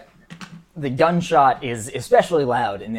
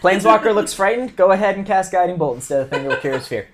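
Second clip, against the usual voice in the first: excited teasing; 5 semitones higher, 6 dB louder than usual. Real, not acted. GM teasing, loud and fast.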